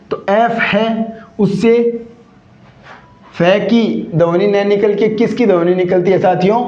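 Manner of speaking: ordinary delivery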